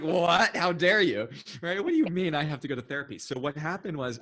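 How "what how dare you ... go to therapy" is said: high, loud laughing indignation; mock outrage. Student retelling her reaction to the teacher, mock outrage. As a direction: play up being offended. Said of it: "I was like".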